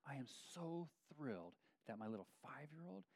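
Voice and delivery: hushed, near-whispered with emotion; choked up, barely voiced; getting emotional in front of the church. Real, not acted. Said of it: "I'm getting a little emotional as I say that".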